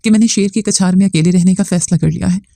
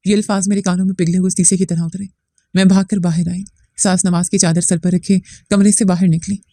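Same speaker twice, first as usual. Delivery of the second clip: ordinary delivery, nothing marked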